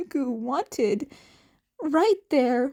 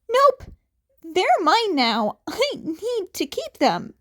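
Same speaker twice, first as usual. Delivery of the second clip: put-on high, louder blurting teen; blurted; 7 semitones higher, 3 dB louder than usual. Izuku refusing to hand over bullets, blurted high. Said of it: "the teen blurted".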